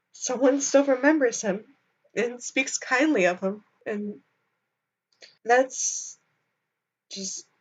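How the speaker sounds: fighting back tears, trailing off; crying, worn out. Real, not acted. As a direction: voice breaking with tears. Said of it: "okay, I can't stop crying".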